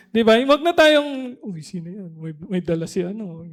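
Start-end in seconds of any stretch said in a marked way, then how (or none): 1.4-3.4 low, hushed gossip about a newcomer. Retold or imitated. Churchgoers gossiping about a new member, hushed. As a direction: mutter it behind their back.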